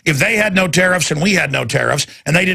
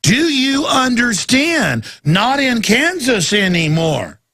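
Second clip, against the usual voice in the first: slow, drawn-out words for emphasis; slow and insistent, as if to someone not getting it; emphatic re-explaining; 4 semitones higher, slower than usual. Real, not acted. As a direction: spell it out slowly, stretching each word. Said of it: "let me explain it again".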